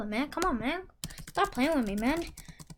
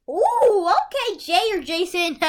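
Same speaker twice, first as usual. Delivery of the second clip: excited; 6 semitones higher, 6 dB louder, slower than usual. Real, not acted. Good pull appears.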